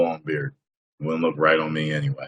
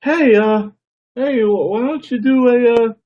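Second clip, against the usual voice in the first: high mock fan voice; squeaky mock requester; imitating a fan asking; 4 dB louder than usual. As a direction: pitch way up like an eager young fan. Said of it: "So they'll just be like".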